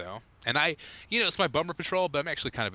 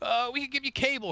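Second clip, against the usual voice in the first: mock Comcast salesman. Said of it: "mock Comcast rep voice".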